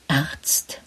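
breathless whispered question; alarmed whisper. Acted. Sick man whispering his dread of doctors. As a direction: hiss the word out in alarm, barely voiced.

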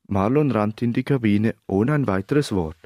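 ordinary delivery

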